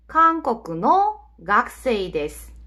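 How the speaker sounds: slow, word-by-word Japanese example; careful teacher's demonstration, drawn out; slow model sentence. Shown as a demonstration. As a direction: model it slowly for learners, phrase by phrase.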